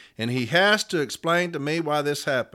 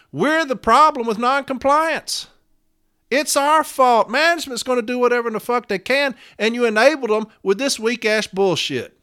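high, raised-voice fury; heated, high-pitched tirade; real angry outburst; 7 semitones higher, 4 dB louder than usual. Real, not acted. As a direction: lash out at your own side. Angry rant blaming own union.

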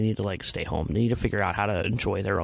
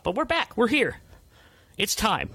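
high triumphant we're back; excited announcement; 10 semitones higher than usual. Real, not acted. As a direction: announce the comeback, pitched up. Announcing return.

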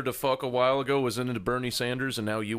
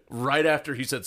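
usual speaking voice

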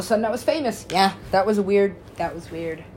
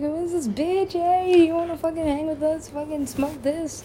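higher, mock rowdy stoner crowd; party crowd voice; 10 semitones higher than usual. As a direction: toss it off like a rowdy stranger. Voicing dance floor crowd.

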